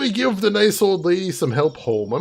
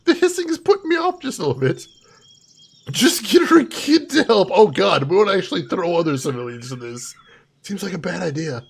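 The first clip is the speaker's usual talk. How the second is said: ordinary talk, nothing marked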